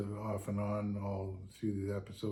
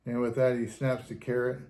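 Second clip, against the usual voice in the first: ordinary delivery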